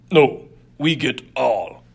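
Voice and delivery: loud, slow, menacing thug voice; dangerous brute. Acted. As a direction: lean into a slow, threatening demand. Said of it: "voice had a dangerous tone".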